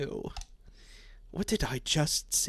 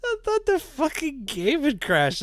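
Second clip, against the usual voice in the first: higher and louder, still shaken; startled exclamation; 12 semitones higher, 5 dB louder than usual. Real, not acted. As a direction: blurt it out in shock. Startled by the game freezing.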